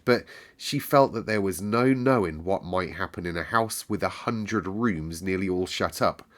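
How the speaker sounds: ordinary delivery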